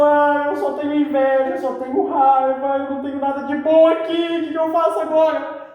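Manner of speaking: high, loud panicked lament; acted desperation. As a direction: cry out as if hopelessly lost. Acts out someone lost, searching heart.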